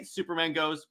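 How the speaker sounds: ordinary talk, nothing marked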